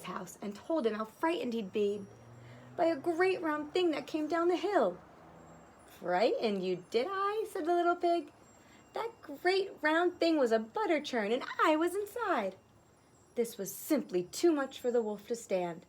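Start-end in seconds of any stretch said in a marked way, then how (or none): 6.0-12.5 high, gleeful piglet voice; cheeky little pig. Acted. Little pig gloating to the wolf, high and gleeful.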